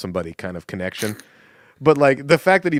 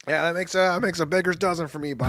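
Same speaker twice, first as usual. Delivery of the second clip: Chicago accent impression; 6 semitones higher, 4 dB quieter than usual. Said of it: "that's just another heart attack".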